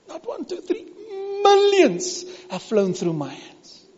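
fighting back tears over the millions; choked up. Real, not acted. Preacher choking up to his congregation. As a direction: voice cracking with emotion, slowed.

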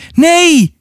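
very high, drawn cry; screaming. Acted. Atta sees the wolf run out, high acted scream. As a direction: let out a contained, high-pitched scream. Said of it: "gilde Atta".

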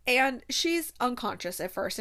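ordinary talk, nothing marked

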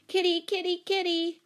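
high-pitched calling; calling a cat. A narrator's reading. Jane and Don running after their cat, calling. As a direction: call after a runaway kitty.

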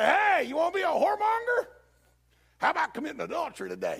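very high, loud holler; mock blatant devil. Devil openly inviting a believer to sin, hammed-up yell. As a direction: shout the proposition like a hawker. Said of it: "the devil don't come to you and say".

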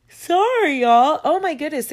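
words stretched out by a real yawn; talking through a yawn. Real, not acted. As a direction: say it mid-yawn. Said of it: "I'm sorry for yawning again".

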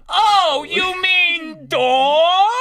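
high, very slow drawn-out surprise; mock realisation. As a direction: drag it out, high, as it dawns on you. Retold Joe's realisation.

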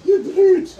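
high, loud cry of outrage; indignant lady shout. Acted. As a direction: shriek it like an affronted lady. Lady NPC shouting at attacker.

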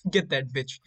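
hammed-up cheer; mock egging on. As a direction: cheer on the beating like a fan. Friend cheering the attack.

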